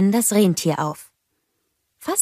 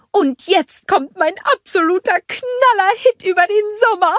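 very high, loud, excited character voice; Olaf announcing; 14 semitones higher, 4 dB louder than usual. A narrator's reading. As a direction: proclaim it like a showman with a big number. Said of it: "verkündete Olaf". (begeisterte, hohe Ankündigung)

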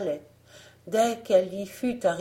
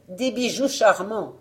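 louder, coaxing seller's voice; peddler's pitch; 3 semitones higher, 5 dB louder than usual. Acted. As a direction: tempt them with your trinkets. Fausse marchande vante ses bijoux.

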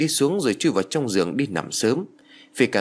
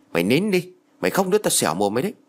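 higher-pitched, mild narrated yell, quickening into a threat; scolding yell; 7 semitones higher, faster than usual. A narrator's reading. Grandfather threatening a crying child, mildly yelled scolding. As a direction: scold in a raised pitch, then rush the threat. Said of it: "chỉ tay vào thằng mặt thằng bé rồi quát".

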